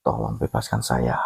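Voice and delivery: a deep, hushed plea from a chained creature; pleading spirit. Retold or imitated. Chained fire spirit begging the narrator to free him, low and hushed. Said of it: "Dia bilang".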